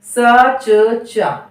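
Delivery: सचचा dragged very slowly, both च in full; wrong drawn-out cha. Shown as a demonstration. Teacher showing how long the wrong सचचा takes. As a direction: stretch सचचा long, two full चा beats. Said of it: "बहुत वक्त लिया इसको बोलने में".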